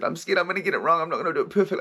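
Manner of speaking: put-on high, loud, rushed scared child; vulnerable child voice. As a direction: plead like a frightened little kid. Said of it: "He said".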